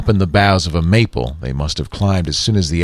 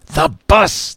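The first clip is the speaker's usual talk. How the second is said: raised, higher urgent warning; urgent call; 9 semitones higher than usual. A narrator's reading. Lee Daniel urging.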